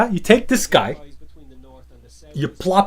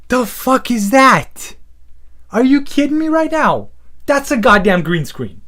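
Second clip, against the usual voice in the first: shocked disbelief; 7 semitones higher, 3 dB louder than usual. Real, not acted. Shocked at green screen.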